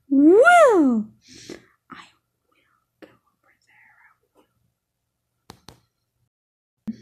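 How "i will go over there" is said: breathy unvoiced whisper. Real, not acted. Kid gamer plotting a sneaky move, whispering. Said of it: "whispered plan in game".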